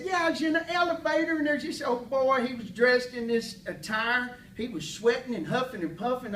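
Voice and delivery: high, loud, excitable country storyteller; Gene Rader. Retold or imitated. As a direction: imitate an eccentric Texan spinning his yarn. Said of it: "He said".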